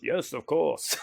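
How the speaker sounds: put-on European-accented agreement; reply in a put-on European accent; Konstantin Radovich. Spirit caller agreeing to be recorded, European accent. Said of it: "a European accent".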